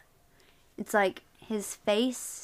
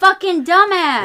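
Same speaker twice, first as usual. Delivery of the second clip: loud laughing insult; 7 semitones higher, 14 dB louder than usual. Real, not acted.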